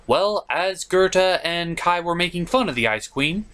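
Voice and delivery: ordinary delivery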